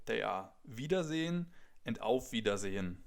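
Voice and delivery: ordinary talk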